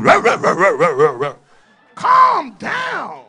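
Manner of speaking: hammed-up rough woman shouting; mock rough woman. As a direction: mimic her snapping back. Mocking a rough-talking woman.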